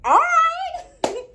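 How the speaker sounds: high-pitched, gleeful agreement; eager child. Acted. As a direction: squeal it like a thrilled little girl. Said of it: "I said".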